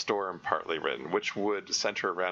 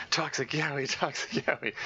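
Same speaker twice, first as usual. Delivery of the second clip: laughing; 6 semitones higher, slower than usual. Real, not acted. Joking about the mod's direction.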